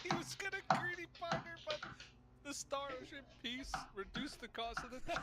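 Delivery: high-pitched, laughing through the words; laughing while talking. Real, not acted. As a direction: giggle through the explanation. Laughing at opponent's misplay.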